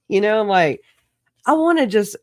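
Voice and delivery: ordinary talk, nothing marked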